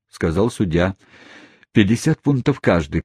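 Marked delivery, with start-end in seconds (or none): none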